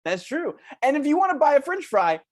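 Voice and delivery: put-on chirpy children's show host talking to kids; lightly voiced kids' TV host, upbeat. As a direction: sell it like a cheesy kids' show presenter. Host pitching fries to kids.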